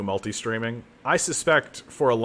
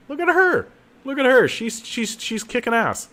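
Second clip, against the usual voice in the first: much higher and louder, delighted; gushing over his new hero, high and loud; excited; 14 semitones higher, 5 dB louder than usual. Real, not acted. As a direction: cheer her on in a high, loud voice.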